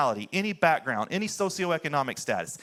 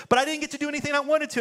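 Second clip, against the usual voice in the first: put-on whiny kid voice; 10 semitones higher, much faster than usual. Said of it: "he's like".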